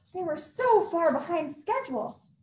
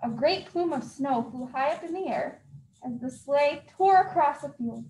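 ordinary delivery, nothing marked

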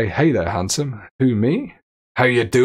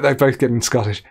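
ordinary delivery

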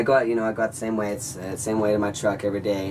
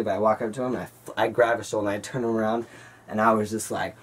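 plain reading voice

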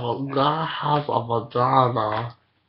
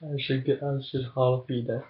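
ordinary delivery

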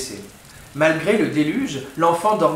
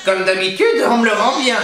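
higher crone voice, bitter; old witch; 6 semitones higher, 5 dB louder than usual. A narrator's reading. Witch grumbling to herself after fleeing, higher crone voice. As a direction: spit it out like a resentful old hag. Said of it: "se dit-elle".

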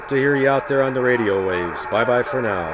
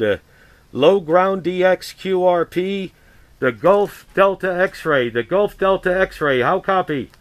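radio call; 5 semitones higher than usual. Acted. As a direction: clear ham radio call voice. Ham radio call on air.

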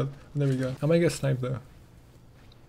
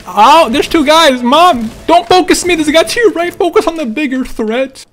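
loud, higher, urgent; excited callouts; 7 semitones higher, 7 dB louder than usual. Real, not acted. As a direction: shout the callouts fast. Callouts during fight.

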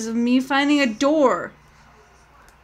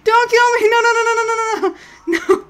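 real shrieking terror, much higher and louder; freaking out; 11 semitones higher, 7 dB louder, much faster than usual. Real, not acted. Streamer telling chat she's about to die, shrieking in terror. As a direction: scream for your life. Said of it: "Guys, I'm freaking out".

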